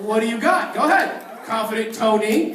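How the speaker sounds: loud, high-pitched teasing call. Real, not acted. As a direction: egg him on at full volume. Host shouting, teasing guest.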